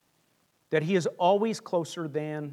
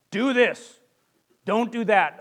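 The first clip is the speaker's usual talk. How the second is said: a hint of a stern commanding God, louder; lightly barked orders; demanding; 5 dB louder, slower than usual. Imitating a demanding God of commandments, mild stern. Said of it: "as merely demanding".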